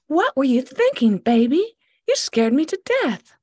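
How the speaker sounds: high, loud relieved scolding. Acted. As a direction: tell off the dog you were scared for. Scolding dog after scare.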